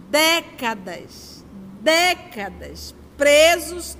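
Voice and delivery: "décadas, décadas" stretched very slowly, then "presos" punched loud; drawn-out emphasis. Real, not acted. Speaker stressing to the audience how long people stay stuck, slow then loud. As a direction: drag out "décadas" twice and hit "presos" hard. Stressing years lost lamenting.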